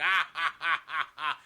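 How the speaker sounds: mock villain laugh; loud, separate staccato ha-has; Ming. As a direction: laugh along like a henchman. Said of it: "Ming is still holding fucking Benoit like".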